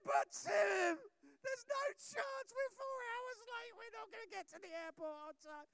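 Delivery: high, tearful, loud protest; panicked cry. Retold or imitated. Pastor voicing Slava insisting they'll miss the flight, high panicked cry. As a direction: plead it as if all is lost.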